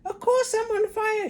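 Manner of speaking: put-on very high, loud panicky old hag shout; shouting Grandma. A narrator's reading. Grandma panics and shouts for the fire brigade. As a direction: yell it like your stomach's burning. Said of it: "she yelled".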